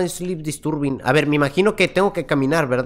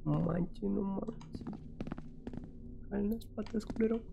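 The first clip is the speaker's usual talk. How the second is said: soft, higher, shaken muttering; 5 semitones higher, 16 dB quieter than usual. Real, not acted. Gamer just after a scare, softly shaken. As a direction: recover from the fright, breathless.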